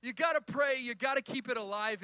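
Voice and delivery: high, very loud preacher's cry; preaching shout. Real, not acted. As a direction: shout it out to the whole congregation. Preacher returns to main point shouting.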